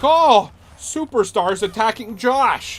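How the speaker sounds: high, loud, drawn-out commentator yell; slow mock announcer. Imitating Michael Cole's call for viewers, slow loud yell. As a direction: stretch it out like an overexcited announcer.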